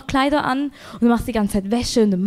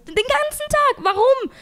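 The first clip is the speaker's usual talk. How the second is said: much higher, slower, indignant squeal; outraged disbelief. Real, not acted. Ranting about neighbours booking the laundry all day, high indignant disbelief.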